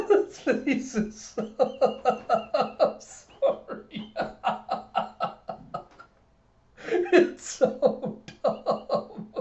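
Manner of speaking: real giggling fit, high drawn-out words; losing it completely, barely able to speak; helpless laughing. Real, not acted. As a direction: gasp the words out between wheezes of laughter. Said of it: "I heard myself laughing".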